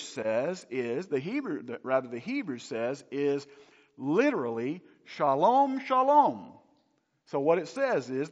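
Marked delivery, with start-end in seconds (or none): 5.0-6.5 "Shalom, shalom" high and loud, both words hit equally; emphatic repetition. Shown as a demonstration. Preacher showing the congregation the literal Hebrew, emphatic. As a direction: ring out "Shalom, shalom" with doubled weight. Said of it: "It's emphatic".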